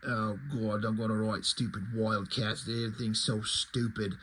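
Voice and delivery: low, soft, weary grumble; grumbling Alan Moore. Retold or imitated. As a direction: mutter it like a bored writer stuck with a job. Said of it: "and he's like".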